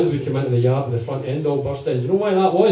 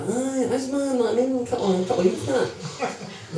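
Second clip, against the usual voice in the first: much higher, softer put-on young lad; voicing the chain boy; 10 semitones higher, 5 dB quieter than usual. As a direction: squeak it out like a naive lad. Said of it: "the boy's like".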